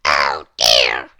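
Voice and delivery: very low, loud little man voice; Mister Small asking. Acted. Lost Mister Small asks for help, very low voice. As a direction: ask politely in a deep voice. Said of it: "said Mister Small".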